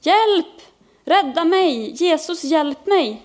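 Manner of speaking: high, urgent cries for help; panicked cry. A narrator's reading. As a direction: cry out to be saved from sinking. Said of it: "ropade han i panik". (paniskt rop på hjälp med hög röst)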